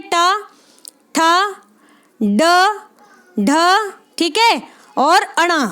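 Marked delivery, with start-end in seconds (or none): 0.0-3.9 ट ठ ड ढ said one by one with long pauses; slow, spaced letter recital; reciting letters. Shown as a demonstration. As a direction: let each letter land before the next. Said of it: "ट वर्ग में कौन सी आती है".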